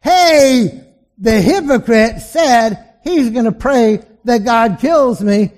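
high, loud jeering call, slow; loud taunting yell; mocking yell. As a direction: shout the joke back to the card table. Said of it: "starts laughing his head off".

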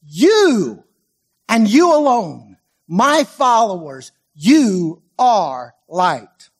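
"You" punched loud, then slow "you are light"; emphatic you. Shown as a demonstration. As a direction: hit "You" hard, then draw it out. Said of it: "the you is emphatic in this sentence".

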